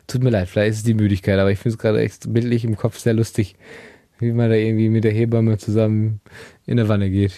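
normal talking voice

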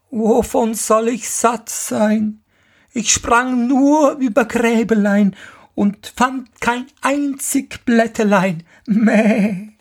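hoch und meckernd wie eine Ziege, klagend; meckernde Ziege. Acted. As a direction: die freche Ziege jammert dem Vater etwas vor. Die Ziege antwortet. (hohe, meckernde Ziegenstimme)

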